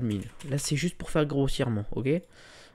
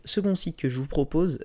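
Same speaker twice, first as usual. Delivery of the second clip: ordinary talk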